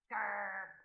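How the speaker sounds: low, drawn-out growl; grumpy growl. Acted. Boy called cute by the dragons, growling back. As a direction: growl in annoyance.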